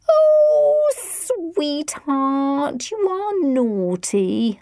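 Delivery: high, slow playful tease; teasing. A narrator's reading. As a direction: coo it teasingly. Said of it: "her mother teased".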